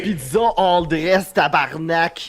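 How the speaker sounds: put-on Quebec French accent. Playing a Quebecer ordering pizza, Quebec French accent. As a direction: order slowly as a Québécois caricature, accent thick.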